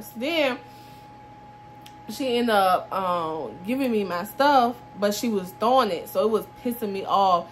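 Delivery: normal talking voice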